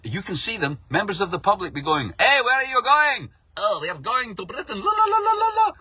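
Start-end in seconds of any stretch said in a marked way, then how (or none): 2.2-3.3 calling out. Retold or imitated. Public calling out to migrants. 3.6-5.7 breezy, taunting singsong; mock carefree migrants. Migrants on the beach answering the public, carefree singsong.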